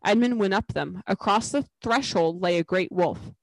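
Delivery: ordinary delivery, nothing marked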